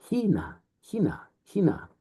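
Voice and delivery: Hina with an h and a high stressed first syllable; rough breathing, acute. Shown as a demonstration. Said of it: "here's your breathing mark, plus an accent".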